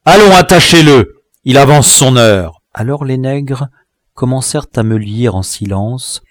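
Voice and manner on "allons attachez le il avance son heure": high, loud command to henchmen; shouted order. A narrator's reading. Dwarf ordering men to bind the captive, shouted order. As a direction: order them sharply to seize him.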